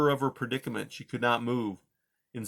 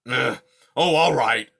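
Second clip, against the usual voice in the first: growled; 8 dB louder than usual. Acted. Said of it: "he growled".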